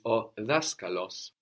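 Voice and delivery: o daskalos, stress on the first syllable DA; careful slow Greek model word; Greek pronunciation. Shown as a demonstration. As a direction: say daskalos slowly as DA-ska-los. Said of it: "The teacher is".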